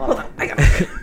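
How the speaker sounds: fast breathy mocking repeat; mocking whisper echo. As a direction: parrot the line back in a teasing whisper.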